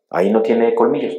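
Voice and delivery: loud, high sermon voice; building to full force at the pulpit; fiery preaching. Acted. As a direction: preach it out to the congregation. Preacher raises voice on scorpions.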